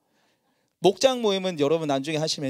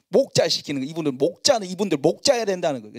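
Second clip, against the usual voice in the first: ordinary delivery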